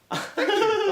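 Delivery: high, loud falsetto thank-you; mocking the wife's line, high and loud. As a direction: squeak it like a cartoon wife. Mimics wife thanking him.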